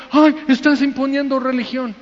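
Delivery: high whining objection; whiny complainer. Retold or imitated. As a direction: whine like someone offended. Offended listener's whiny complaint. (queja chillona y aguda)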